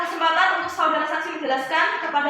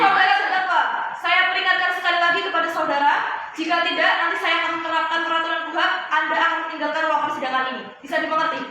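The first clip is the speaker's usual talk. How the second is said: raised, higher voice of authority; stern warning; 3 semitones higher, 5 dB louder than usual. Acted. Judge threatens defendant with removal from court, stern loud warning. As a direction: loudly lay down the law from the bench.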